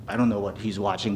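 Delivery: usual speaking voice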